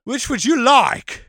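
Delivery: high, drawn-out Cockney question; mock Cockney. Podcaster finishing his sweep impression, slow mock-Cockney. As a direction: stretch it out in hammy Cockney. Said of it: "You're not Cockney".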